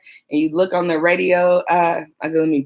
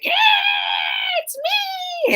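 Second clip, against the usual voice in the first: mock cheer; much faster than usual. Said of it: "didn't go into work like".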